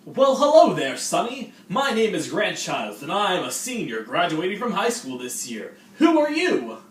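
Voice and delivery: high, loud hammed-up upbeat senior; high, loud mock-cheerful older student; cheery senior. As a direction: greet the freshman like an overeager host. Skit: senior greeting a freshman.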